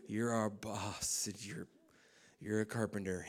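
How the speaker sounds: slow, hushed mock worship; mock reverent whisper. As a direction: whisper a mock prayer to yourself. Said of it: "just going".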